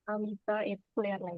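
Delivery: faint, far-off voice on the call; too quiet to hear clearly; barely audible. Real, not acted. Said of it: "Your voice is very low".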